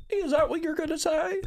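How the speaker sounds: very high, mimicking voice; mimicked Doctor Phil line. Imitating a friend's accent impression, high voice. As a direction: quote him in a high put-on voice. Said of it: "He was like".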